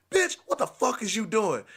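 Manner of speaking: high-pitched angry demand; still going off. Retold or imitated. Boyfriend confronting her behind closed door, high and angry. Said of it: "I'm still going off".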